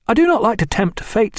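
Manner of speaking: lightly voiced keen young officer. A narrator's reading. Reed voiced in narrated dialogue.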